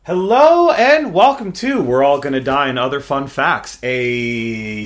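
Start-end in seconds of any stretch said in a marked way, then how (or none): none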